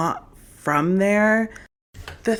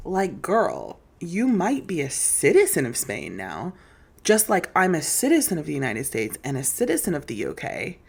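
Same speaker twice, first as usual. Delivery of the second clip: normal talking voice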